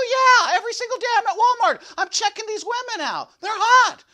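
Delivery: loud, high, eager bragging; excited boast. Retold or imitated. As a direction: blurt it out proudly, a bit fast. Retold confession, excited boast.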